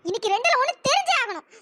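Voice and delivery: normal talking voice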